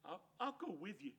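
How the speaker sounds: far, timid, stammering small voice; timid sidekick. Acted. Drover timidly offering to go with Hank, small stammering voice. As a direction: offer bravely but shyly.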